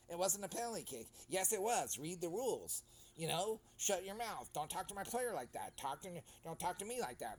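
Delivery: retold squabble, lightly hammed; mock argument. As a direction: play both sides of a shouting match. Acted quarrel with referee.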